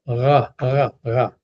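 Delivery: "ra" with a guttural Hebrew R; repeated throaty demo of "ra"; back-of-throat R. Shown as a demonstration. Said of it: "pronounced more towards the back of the throat".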